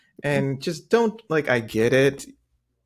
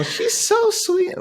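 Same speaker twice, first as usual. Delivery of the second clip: Southern belle; slower than usual. Retold or imitated. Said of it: "doing the Southern thing".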